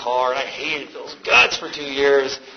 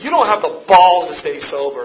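louder, higher retold challenge; mock challenge; 4 semitones higher, 6 dB louder than usual. Little woman daring a tough newcomer, challenging. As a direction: challenge him, punching the key word.

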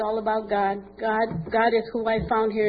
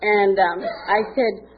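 ordinary talk, nothing marked

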